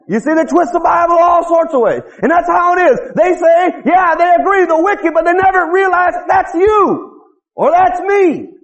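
high-pitched, driving pulpit delivery; forceful preaching. Real, not acted. Preacher telling congregation how the wicked excuse their sins, forceful.

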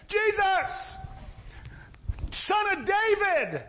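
high-pitched, stretched-out cry; acted beggar's holler. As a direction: call out desperately over a crowd. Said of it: "So he starts a hollering".

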